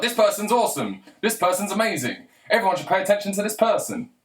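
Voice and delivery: high-pitched, gushing promotion; mock hype. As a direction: hype them up with exaggerated enthusiasm. Voicing hype about a famous nobody.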